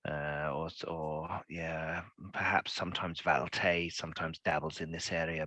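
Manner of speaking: low, quiet musing to himself; hesitant, hushed scholarly pondering; pondering appraiser. Acted. GM voices appraiser examining the stone.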